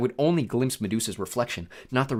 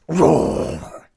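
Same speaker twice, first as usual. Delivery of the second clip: loud raging roar; sudden long bellow; monster roar; 4 semitones higher, 7 dB louder than usual. A narrator's reading. Medusa roars back to life.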